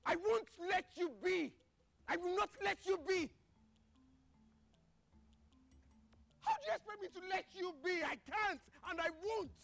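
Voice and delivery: insistent, each word driven home; heated, stubborn protest; defiant refusal. Acted. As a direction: dig in and refuse to back down. Heated quarrel, refusing to back off.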